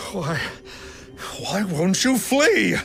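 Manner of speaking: stammer, then cry out in dismay; panicked failed exorcist. Acted. Exorcism fails, demon will not flee.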